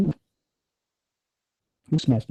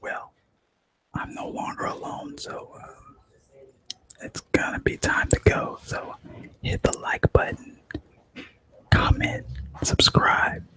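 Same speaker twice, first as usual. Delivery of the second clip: whisper; 3 dB quieter, slower than usual. Real, not acted. Whispered outro, not alone.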